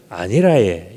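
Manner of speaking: low Gyeongsang-accented denial; Gyeongsang dialect. Shown as a demonstration. Preacher gives the easiest dialect denial, low Gyeongsang accent. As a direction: deny it in a low Gyeongsang lilt. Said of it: "또 제일 쉬운 건 뭐예요?".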